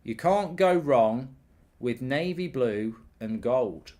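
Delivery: slow, deliberate, like reading out a note; dictating slowly. Shown as a demonstration. Streamer dictates his kit verdict for later, slowly. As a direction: spell it out as if writing it down. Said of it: "I'm gonna write that down".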